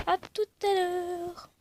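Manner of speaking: high, slow, drawn-out sign-off; sing-song goodbye. Real, not acted. YouTuber to viewers before a break, sing-song goodbye. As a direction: stretch it out in a high lilt.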